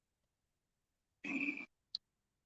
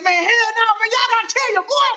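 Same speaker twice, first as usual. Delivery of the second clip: higher, pressing plea to be let in on it; pleading excitedly; 12 semitones higher than usual. Retold or imitated. Begging his friends to tell him the secret, high and pressing. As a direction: beg the crew, half laughing.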